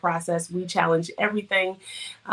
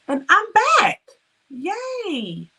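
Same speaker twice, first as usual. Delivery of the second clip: high, loud happy announcement; bursting with joy at being back; excited; 12 semitones higher, 6 dB louder, much slower than usual. Real, not acted. Announcing her return.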